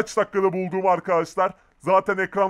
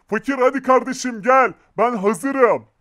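loud, higher call to a friend; 5 semitones higher, 6 dB louder than usual. Real, not acted. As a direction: call out that you're ready. Calling out from hiding spot.

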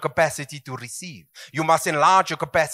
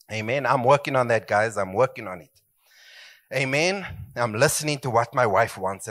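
ordinary talk, nothing marked

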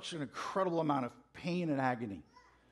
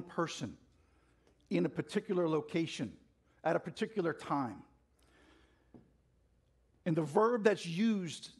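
higher, fast and passionate preaching; fired up preaching; 4 semitones higher than usual. Real, not acted. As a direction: preach it with rising fire. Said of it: "I'm getting a little fired up".

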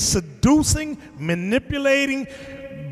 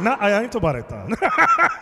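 ordinary delivery

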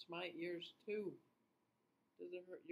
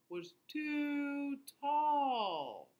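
stretched high; 10 semitones higher, 10 dB louder, much slower than usual. A narrator's reading. Stretching the giraffe-like sheep.

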